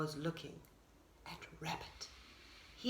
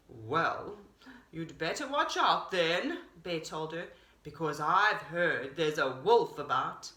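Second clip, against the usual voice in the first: low bear warning; 6 semitones lower than usual. A narrator's reading. Bear warns Deer of a wolf, low.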